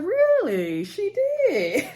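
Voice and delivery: high, incredulous imitation; mock disbelief. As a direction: act out a mother's disbelief with pitch shooting up. Retold mother hearing unwelcome news.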